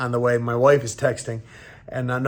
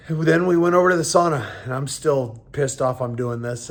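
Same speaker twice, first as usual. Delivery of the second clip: ordinary talk, nothing marked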